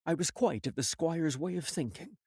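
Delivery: usual speaking voice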